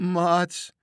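high, loud cry to his mates; lost sailor crying out to his old crew; calling out. A narrator's reading. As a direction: call out at last after long silence. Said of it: "riep hij eindelijk".

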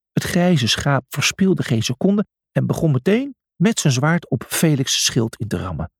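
ordinary delivery, nothing marked

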